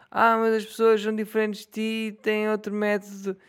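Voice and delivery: high mocking imitation of other podcasters; mock whiny podcaster. Mocking rival podcasters who edit their show, high and whiny. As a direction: parrot their excuse in a high, silly voice.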